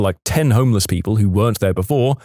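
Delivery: ordinary delivery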